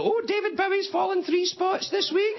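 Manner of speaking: very high, squeaky mock mum voice with laughter. Mum listening to the pop charts, very high laughing mock voice. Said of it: "my mum would even listen".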